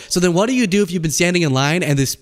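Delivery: ordinary delivery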